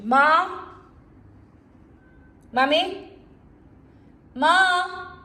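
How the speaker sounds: loud high call across the house; yelling across the room. Real, not acted. Calling mother from afar.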